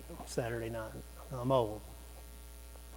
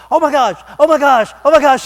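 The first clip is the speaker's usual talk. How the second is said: high, loud frantic repeats; mock panic; 9 semitones higher, 11 dB louder, faster than usual. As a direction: imitate a panicking girl yelling. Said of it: "they are screaming and jumping up and down".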